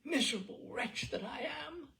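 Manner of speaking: a high, pained groan of despair. A narrator's reading. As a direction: moan it out like a broken man. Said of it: "groaned Jabez Stone".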